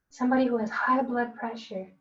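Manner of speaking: usual speaking voice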